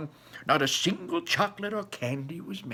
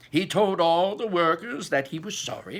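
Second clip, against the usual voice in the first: ordinary delivery, nothing marked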